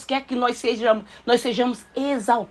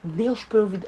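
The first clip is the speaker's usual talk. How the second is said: ordinary talk, nothing marked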